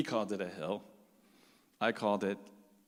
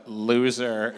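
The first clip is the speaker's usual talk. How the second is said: louder mock sneer; scornful taunt; 3 semitones lower, 7 dB louder than usual. Gang guys sizing up the Bedouin guy, scornful. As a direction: jeer it, imitating cocky tough guys.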